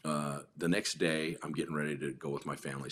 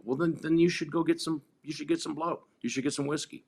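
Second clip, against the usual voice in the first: mock permission; 11 semitones higher, 3 dB louder than usual. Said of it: "and I go".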